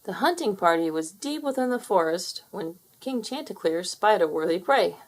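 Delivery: ordinary delivery, nothing marked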